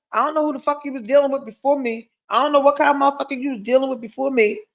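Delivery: fast, light imitation of her man; mock male quote. Retelling what her man says, quick light mock quote. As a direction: rattle off his line quickly. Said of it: "often says".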